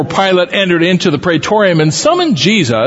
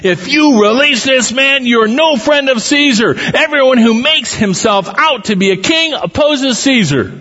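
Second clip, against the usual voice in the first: high-pitched, emphatic protest; crowd crying out; 10 semitones higher than usual. A narrator's reading. Crowd pressuring Pilate against releasing Jesus, crying out. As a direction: read it out as a high, insistent outcry.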